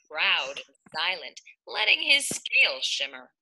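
usual speaking voice

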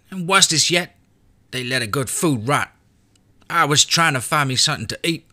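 put-on a hungry boy grumbling about the monsters; high child's voice, frowning; frowning kid. A narrator's reading. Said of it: "He frowned and said".

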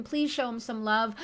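ordinary talk, nothing marked

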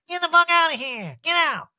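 put-on high, loud, drunken order to leave; hammed-up angry impression; mock drunk yelling. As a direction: holler them out of the room. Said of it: "hammered, is like".

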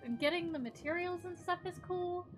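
bright, eager rush of enthusiasm; excited. Real, not acted. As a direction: gush about it. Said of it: "The building makes me so excited".

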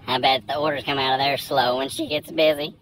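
ordinary talk

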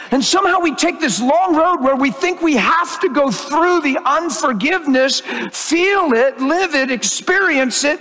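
high-pitched fervent preaching; fervent sermon voice; impassioned preaching. Acted. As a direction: preach it with rising passion. Preaching at full force, raised.

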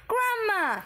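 high, loud frightened cry. A narrator's reading. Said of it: "he cried out".

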